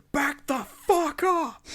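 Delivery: high, slow snarled command; imitating Tom Hanks snapping at paparazzi; angry outburst. Tom Hanks snaps at the paparazzi, imitated high furious outburst. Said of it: "he just goes".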